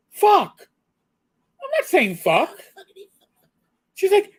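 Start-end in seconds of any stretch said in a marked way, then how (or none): 0.1-2.6 high, loud mock-indignant mom; imitating indignant mother. Mother scolding her son at the dinner table, high indignant voice. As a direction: spit the word out like an offended mother.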